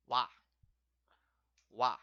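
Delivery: wa said short and clear; model word wa. Shown as a demonstration. Teacher says the particle wa for students, short and clear. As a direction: give wa crisply. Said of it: "which is".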